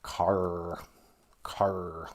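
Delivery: "Car" with a long, heavy R; Midwestern hard R. Shown as a demonstration. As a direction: pull the R in "Car" out low and long. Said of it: "when we pronounce our R's".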